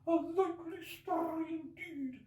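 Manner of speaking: very high, slow bird voice full of scorn; contemptuous pigeon. Acted. Pigeon scoffing at Alice, contemptuous. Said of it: "in a tone of the deepest contempt".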